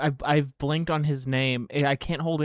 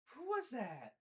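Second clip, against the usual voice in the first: soft cooing dog talk, often high; 5 semitones higher, 23 dB quieter than usual. Real, not acted. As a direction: coo softly at the dog, curious. Talking to the dog.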